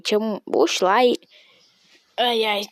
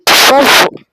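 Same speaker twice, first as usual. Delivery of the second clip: high, very loud burst; shouted plea; 4 semitones higher, 11 dB louder than usual. Pretend. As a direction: yell a sudden plea. Sudden shouted plea on stream.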